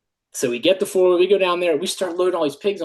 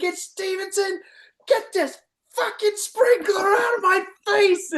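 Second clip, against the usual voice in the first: very high, strained mock yell; imitated furious yelling; 14 semitones higher than usual. Timmons hit in the face by the sprinkler, yelling. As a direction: imitate a soaked boss screaming in outrage. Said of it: "he just started yelling".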